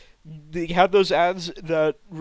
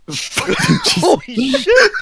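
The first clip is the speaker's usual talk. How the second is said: laughing; 10 dB louder, much slower than usual. Real, not acted. Bursts out laughing.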